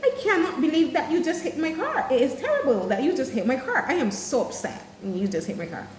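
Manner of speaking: stiffly correct, overly polite complaint; mock formal complaint. Shown as a demonstration. Driver whose car was hit, mock-formal complaint. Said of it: "Are they speaking to each other like".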